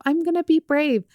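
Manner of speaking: put-on high, bright little-girl voice, smiling; smiling child. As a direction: chirp it like a proud little girl. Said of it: "she would smile and go".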